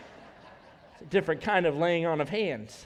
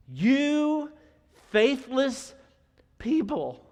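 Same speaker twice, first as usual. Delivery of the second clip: slow, loud exasperation, word by word; frustrated Jesus; 5 semitones higher, 5 dB louder, much slower than usual. Acted. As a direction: rebuke them in drawn-out frustration. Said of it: "Jesus was clearly frustrated".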